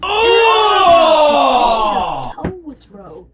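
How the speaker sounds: loud high drawn-out "oh"; startled scream. Real, not acted. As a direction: cry out in sudden fright, sliding down. Startled by scary video.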